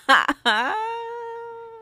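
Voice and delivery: real high-pitched giggling laugh. Real, not acted. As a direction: laugh trailing into a high squeal. Laughs after the smug retold line.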